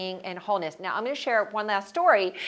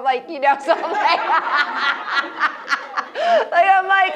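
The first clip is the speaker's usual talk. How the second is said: laughing; 8 semitones higher, 6 dB louder than usual. Real, not acted.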